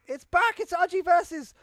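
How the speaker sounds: excited announcer. Acted. Hyping the show return.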